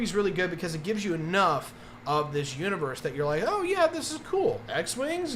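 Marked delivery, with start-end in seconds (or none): 3.4-5.3 high-pitched, drawn-out mock wonder; acted delighted discovery, high and lingering; impressed viewer. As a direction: play a newly hooked viewer, pitched way up. Voices an impressed first-time viewer.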